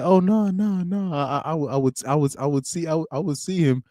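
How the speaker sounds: lightly voiced, high and halting, stumbling over the words. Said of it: "He said, he said".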